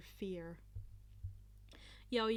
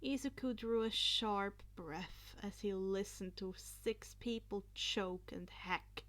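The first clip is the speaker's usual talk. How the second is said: plain reading voice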